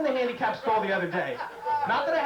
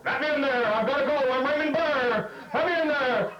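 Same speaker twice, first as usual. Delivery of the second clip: loud, higher demanding yell; Raymond Burr; 7 semitones higher, 4 dB louder than usual. Retold or imitated. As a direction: bang on the stall door shouting.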